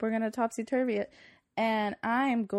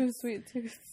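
usual speaking voice

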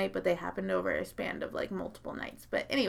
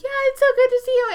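very high, loud, fast parody; mock gushing reply; 10 dB louder, much faster than usual. Grandpa answering the ex, high fast mock greeting. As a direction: echo the greeting in a silly falsetto.

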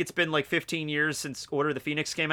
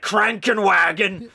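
loud, high mock-German bark; angry German; 6 semitones higher, 8 dB louder, slower than usual. Comparing German words to the others, angry German accent. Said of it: "It always sounds so angry".

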